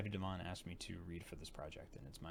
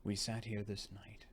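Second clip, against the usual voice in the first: usual speaking voice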